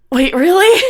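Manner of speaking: can hardly believe the news; stunned disbelief. Acted. Player voices character hearing her brother is back, stunned. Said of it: "all the blood drains out of her face".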